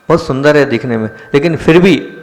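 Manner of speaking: normal talking voice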